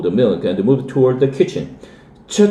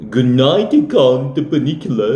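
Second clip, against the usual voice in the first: sarcastic, lightly hammed Transylvanian accent; mock Transylvanian accent; 3 semitones higher than usual. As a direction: tease the bunny like a mock Dracula in a Transylvanian accent. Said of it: "said sarcastically ... Transylvanian accent".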